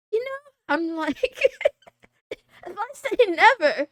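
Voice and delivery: laughing while speaking. Real, not acted. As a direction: laugh through the words. Laughing through her own point.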